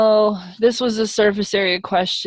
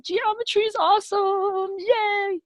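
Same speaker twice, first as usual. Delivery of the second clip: mock cheer; 13 semitones higher than usual. Cheering after solved step.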